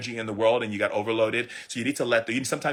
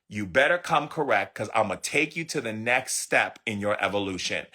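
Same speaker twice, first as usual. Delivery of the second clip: normal talking voice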